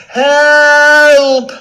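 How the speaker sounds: long high yells; trapped lion's desperate, hammed-up cries; calling for help. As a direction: holler at full force from a trap. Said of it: "He called for help".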